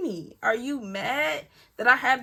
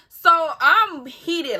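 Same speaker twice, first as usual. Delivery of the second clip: slow, higher and louder, stretched out; heated; 7 semitones higher, 7 dB louder, much slower than usual. Retold or imitated. Telling friends how angry she got, slow loud heated emphasis. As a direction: let the irritation boil up as you say it. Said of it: "my blood's starting to boil".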